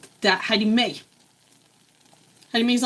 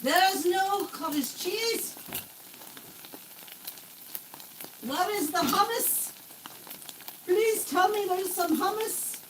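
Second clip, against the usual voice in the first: mock despair; 8 semitones higher, 6 dB quieter than usual. Mock despair over food.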